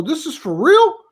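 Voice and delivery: high, rising incredulous question; mildly acted amazement, pitch climbing to the end; retold disbelief. Player asks the director if the call-up is real, incredulous.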